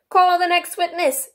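high, slow, loud summons; King. A narrator's reading. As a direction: call it out in a ringing royal voice. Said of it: "said the King".

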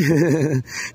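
low chuckle to himself; short chuckle. Real, not acted. Speaker taunting Kurt about being alone, short chuckle. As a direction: chuckle briefly mid-taunt.

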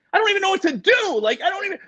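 high, rapid flustered outburst; retold panic. Guest to brother before going on air, high panicky voice. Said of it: "like, really panic".

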